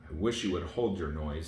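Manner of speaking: ordinary delivery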